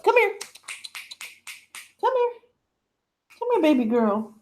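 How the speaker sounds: high, sing-song call to a puppy. Real, not acted. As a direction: coax the dog over in a high voice. Calling the puppy over.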